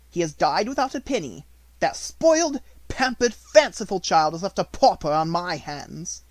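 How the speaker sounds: resentful at being saddled with her; bitter headmistress. A narrator's reading.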